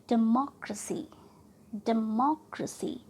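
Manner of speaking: Democracy with MO stressed. Shown as a demonstration. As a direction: lean on 'मो' in democracy. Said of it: "हम मॉ को प्रोना". (Democracy में 'मो' पर ज़ोर)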